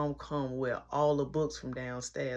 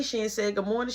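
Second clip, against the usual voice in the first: ordinary talk, nothing marked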